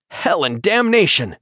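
sharp cry of pain, pitched high; shouting. A narrator's reading. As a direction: yell it out in sudden pain. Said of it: "He shouted".

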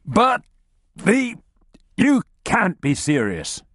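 high stammering disbelief; stunned disbelief. Acted. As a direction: stammer in shock. Analyst in disbelief.

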